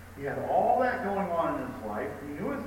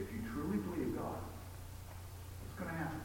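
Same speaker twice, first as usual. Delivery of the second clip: ordinary delivery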